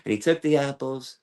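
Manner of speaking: ordinary talk, nothing marked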